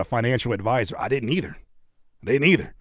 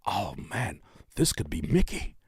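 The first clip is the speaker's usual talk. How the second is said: ordinary delivery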